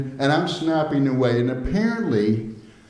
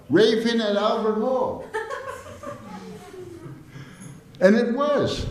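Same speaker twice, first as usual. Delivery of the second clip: laughing; 13 semitones higher than usual. Real, not acted. Punchline laughed out.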